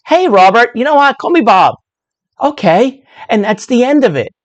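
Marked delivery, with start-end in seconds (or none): 0.1-1.7 friendly correction. Pretend. Acted barbecue exchange. 2.4-3.0 easy okay. Pretend. Acted acceptance.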